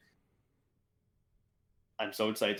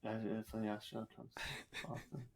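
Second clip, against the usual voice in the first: half-asleep mumble, much softer; mumbled from a coma, far quieter; comatose mumble; 2 semitones lower, 15 dB quieter than usual. Pretend. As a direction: slur it like you're barely conscious. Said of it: "all you hear is".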